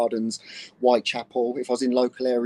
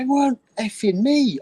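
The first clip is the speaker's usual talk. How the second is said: mock angry protest; 11 semitones higher, 6 dB louder than usual. Retold angry denial.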